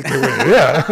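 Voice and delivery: said through a laugh, falling at the end. Retold or imitated. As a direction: laugh it out, pleased with yourself. Laughing while recalling own idea. (riendo, con la entonación que cae al final)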